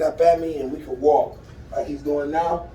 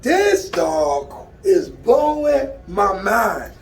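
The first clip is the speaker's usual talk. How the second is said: amazed, higher voice with drawn-out words; real excitement; 3 semitones higher than usual. Real, not acted. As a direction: stretch the key words, thrilled. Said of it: "I am excited now".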